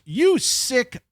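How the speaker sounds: high, slow, drawn-out mock disgust; mock scolding. Girlfriend hits him at the restaurant bar, mock scolding. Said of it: "and she goes".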